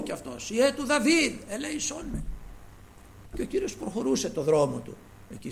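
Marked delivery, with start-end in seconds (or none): 0.5-2.2 high pleading shout, fading at the end; crying out. Retold or imitated. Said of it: "Φώναζε δυνατά". (ικετευτική κραυγή)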